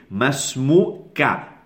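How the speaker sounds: Masmuka drawn out syllable by syllable; teacher slowly models Masmuka for students to repeat; slow model word. Shown as a demonstration. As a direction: spell Masmuka out slowly for the class. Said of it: "Everyone repeat".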